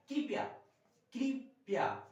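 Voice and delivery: teacher modelling कृपया loudly; emphatic demo. Shown as a demonstration. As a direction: ring out कृपया for the class. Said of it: "इसे लिखते हैं कृपया".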